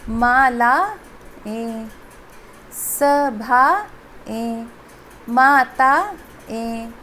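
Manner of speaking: slow, split into stem and ending; deliberately broken apart; separated parts. Shown as a demonstration. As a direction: pause between word and ए. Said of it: "समझाने के लिए अलग-अलग बोल रही हूँ".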